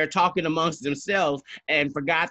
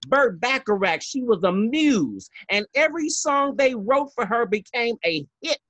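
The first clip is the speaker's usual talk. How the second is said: high-pitched, indignant diva rant; Fernando; 4 semitones higher than usual. Acted. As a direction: scold emphatically, driving each point home. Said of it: "I'm gonna be Fernando for a second".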